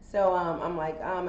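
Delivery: ordinary delivery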